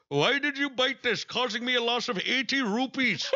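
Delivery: high, mock Indian accent scolding a mouse; imitating Indian man. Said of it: "he's going".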